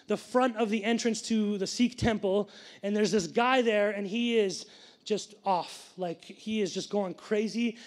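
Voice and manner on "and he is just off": normal talking voice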